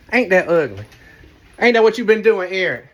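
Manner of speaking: loud, high mock scold; playful accusing. Real, not acted. Confronting husband Eric about hiding his winnings, loud mock scolding. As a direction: scold him half-laughing.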